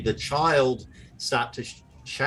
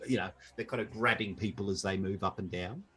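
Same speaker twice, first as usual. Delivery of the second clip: ordinary talk, nothing marked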